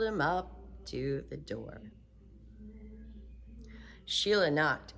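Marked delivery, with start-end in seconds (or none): none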